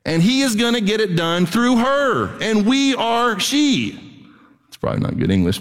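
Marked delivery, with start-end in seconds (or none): 2.4-4.0 she drawn out slowly and falling; slow, weighty we are she; slow emphatic. Shown as a demonstration. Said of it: "it sounded better than".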